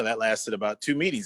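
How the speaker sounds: ordinary talk, nothing marked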